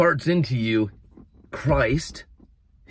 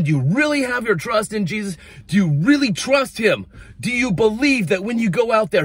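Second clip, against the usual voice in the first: impassioned preaching; 7 semitones higher than usual. Acted. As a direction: urge the congregation, voice lifted. Preaching at full force.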